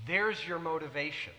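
ordinary talk, nothing marked

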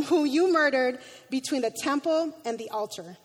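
ordinary talk

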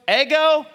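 ego, EH-go, said high and loud; Greek word ego. Shown as a demonstration. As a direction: ring out ego as EH-go. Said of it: "like Lego my egos".

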